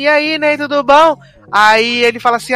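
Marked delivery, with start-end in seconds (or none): 0.0-1.2 voiced little boy. Voicing the little boy greeting.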